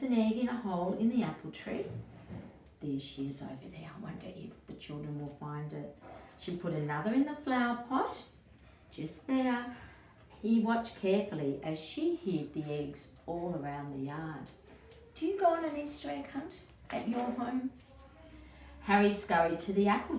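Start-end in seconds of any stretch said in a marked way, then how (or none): 2.8-6.0 quiet confiding aside to the listener; soft aside. A narrator's reading. Narrator quietly pointing out the hidden egg. 15.1-17.7 put-on high, friendly question to the child. A narrator's reading. Storyteller asking the child about egg hunts. Narrator asks young listener.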